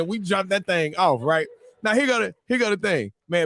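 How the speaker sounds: ordinary delivery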